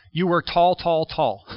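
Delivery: "tall" said three times, each landing firmly; repeated emphasis. Shown as a demonstration. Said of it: "that's how they emphasize the point".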